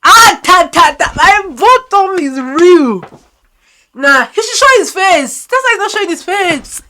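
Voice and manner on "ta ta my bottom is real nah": ordinary talk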